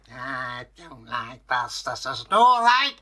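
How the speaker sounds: creature whine that climbs sharply at the end; complaining Kelpie. A narrator's reading.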